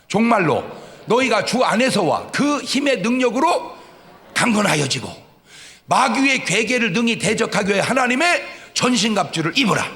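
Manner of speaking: solemn pulpit reading of scripture. Acted. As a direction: proclaim the verse to the church. Preacher reads scripture aloud.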